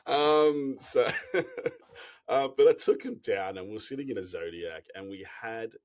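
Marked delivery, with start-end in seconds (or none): 1.1-2.2 breathy laugh. Real, not acted. Guide laughing before the orca story, breathy laugh.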